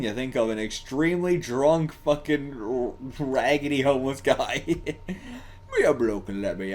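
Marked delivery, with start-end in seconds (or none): 5.1-6.7 slow attempted British accent; lightly done British accent. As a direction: try a Cockney bloke. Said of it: "I can't do a fucking British accent".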